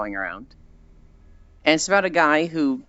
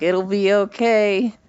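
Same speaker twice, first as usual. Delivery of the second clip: pat-on-the-back soothing, pitched up; comforting friend; 7 semitones higher, slower than usual. Pretend. Core comforting a crying streamer, high soothing voice. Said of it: "And she's like".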